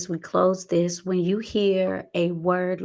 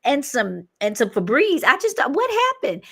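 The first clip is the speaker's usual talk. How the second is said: high, louder mock bafflement at the haul; bewildered shopper; 7 semitones higher, 4 dB louder than usual. Coach imitating someone back from Target, mock bewildered. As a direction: act out a baffled spender, pitch leaping up. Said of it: "come back and say".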